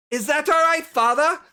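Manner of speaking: loud, high pointed question; calling to father. Acted. Daughter checking with watching father, loud and high. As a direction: throw the question across the room.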